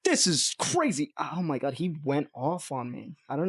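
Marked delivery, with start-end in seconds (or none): 0.0-1.1 quoting angry musician. Retold or imitated. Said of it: "He's like".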